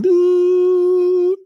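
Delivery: loud, high beep sound; imitated tone. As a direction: blast out a sharp electronic tone. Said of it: "like, this tone".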